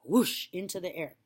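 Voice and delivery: usual speaking voice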